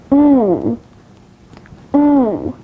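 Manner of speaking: long, held "oo" as in tube; isolated "oo", drawn out like the vowel in you; long vowel demo. Shown as a demonstration. Teacher showing learners long vowels, "oo" held long. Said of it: "Now let's move on to long vowels".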